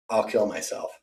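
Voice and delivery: drops to a near-murmur; sudden quiet. Real, not acted. Ranting at a rival, then a quiet threat.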